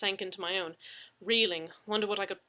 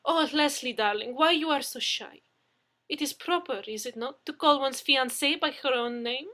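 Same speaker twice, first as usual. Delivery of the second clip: loud, high, doting Russian; Russian fiancée teasing shy Leslie, in a Russian accent; 6 semitones higher, 9 dB louder than usual. Acted. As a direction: coo at your darling in a Russian accent. Russian woman character held.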